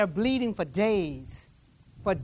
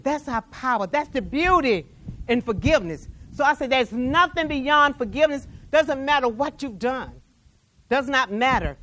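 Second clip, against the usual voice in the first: raised, louder preaching voice; 4 semitones higher, 4 dB louder than usual. Acted. Preacher declaring forgiveness to the congregation, full force. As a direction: drive it home to the church.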